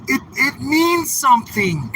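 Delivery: high, whiny mocking voice; mock the guy's earnest plea; lightly whiny impersonation of the viewer; mocking impression. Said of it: "He's like".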